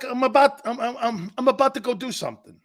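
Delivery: high, fast mock explaining. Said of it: "It's, it's like".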